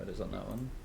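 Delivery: ordinary talk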